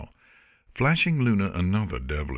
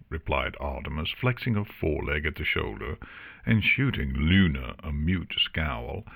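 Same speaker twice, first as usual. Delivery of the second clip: ordinary delivery, nothing marked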